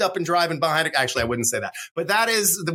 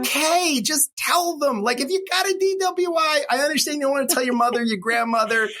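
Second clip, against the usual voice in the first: ordinary talk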